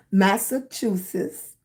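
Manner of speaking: "Massachusetts" spelled out slowly, syllable by syllable; careful slow "Massachusetts". Shown as a demonstration. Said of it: "I have to say it very slow".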